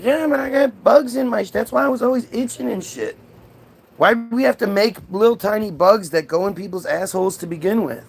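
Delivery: high-pitched comic complaint; protesting Adam. Acted. Adam explains the itchy bugs to God, protesting.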